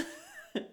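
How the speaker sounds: very high squeal of laughter. Real, not acted. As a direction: let out a squealing giggle. Nervous joke to wife on plane.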